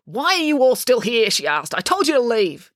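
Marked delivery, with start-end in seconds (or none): 0.0-1.3 high, irritated question; grumpy old lady's raised pitch; cross demand. A narrator's reading. Cat lady still angry. 1.7-2.7 higher, irritated reminder; cross order. A narrator's reading. Cat lady still angry.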